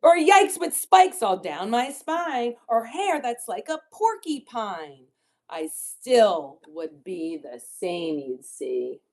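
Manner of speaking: picture-book rhyme reading, key words punched; children's story reading. A narrator's reading. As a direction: read the rhymes with big playful swoops in pitch. Reads rhyming book to children.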